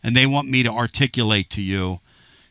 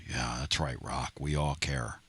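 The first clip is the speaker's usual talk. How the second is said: dry, much lower and softer sarcastic mumble; deadpan sarcasm, voice dropped low and soft; sarcastic aside; 10 semitones lower, 14 dB quieter than usual. Real, not acted. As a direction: toss off a mock-caring aside under your breath. Sarcastic aside about The Rock.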